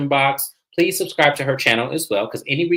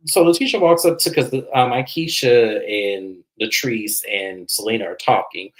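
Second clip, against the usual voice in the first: ordinary talk, nothing marked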